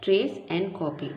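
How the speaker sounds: trace and copy said slowly; teacher stressing a term; slow, clear naming. Shown as a demonstration. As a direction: slowly spell out the method's name. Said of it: "इसको बोलते हैं".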